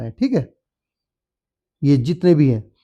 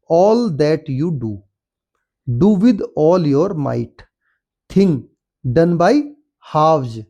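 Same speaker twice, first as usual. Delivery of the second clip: very slow, careful reading of the poem lines; slow reading aloud; much slower than usual. Shown as a demonstration. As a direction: read each word slowly for the class. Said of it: "Read aloud and". (धीमी, साफ़ कविता-पाठ)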